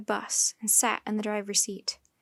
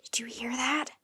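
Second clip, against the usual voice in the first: aside to sister; 6 dB quieter than usual. A narrator's reading. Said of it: "Jack said to Annie".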